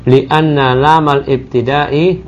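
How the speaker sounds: slow model of Li anna lamal ibtida'i; slow corrected model. Shown as a demonstration. Teacher slowly models the correct phrase for the student. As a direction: recite it slowly for the student to copy. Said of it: "teacher corrects reading slowly".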